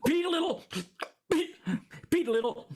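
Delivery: hiccuping mid-word; words broken by hiccups. Pretend. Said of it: "you all suddenly have the hiccups".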